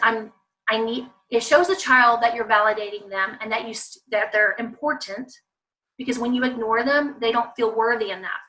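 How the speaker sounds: ordinary talk, nothing marked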